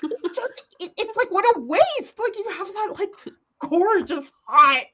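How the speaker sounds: high-pitched, half-laughing rant; worked-up, giggly dismay; exasperated fangirl lament. Real, not acted. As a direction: lament it like a distraught fan, voice way up. Mock-distressed rant over wasted accent.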